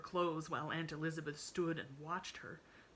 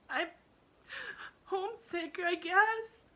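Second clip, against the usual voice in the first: put-on very high, tearful child's confession; sobbing girl; 7 dB louder, slower than usual. A narrator's reading. Emily admits she is homesick, high and sobbing. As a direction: choke it out through sobs. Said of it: "sobbed Emily".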